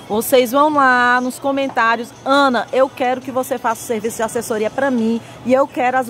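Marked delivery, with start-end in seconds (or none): none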